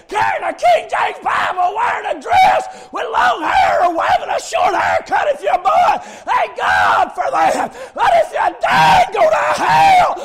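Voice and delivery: high, loud revival preaching; full-throated, urgent sermon shout; preaching at full force. Real, not acted. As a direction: shout the warning at the sinner.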